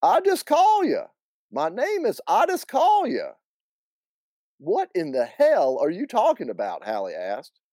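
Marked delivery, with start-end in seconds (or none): 0.0-3.3 put-on high, thick North Carolina accent; Southern accent running the words together. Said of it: "he replied in a thick accent". 4.6-6.8 high-pitched, baffled Southern aunt; indignant, pitched-up question; exasperated. Acted. Acted exasperated aunt questioning the man.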